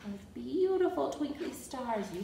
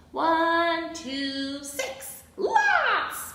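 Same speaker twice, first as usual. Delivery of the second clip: acted bellow leaping high on the last word; 7 semitones higher, 5 dB louder, much slower than usual. A narrator's reading. Zebra counting badly for Abigail, acted bellow. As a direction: count boldly, then bellow the punchline. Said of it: "bellowed Zebra".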